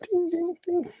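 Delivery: high ringing ding-ding sounds; imitated bell ding. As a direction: mimic a little service bell. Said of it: "That's a guy from Breaking Bad with the bell".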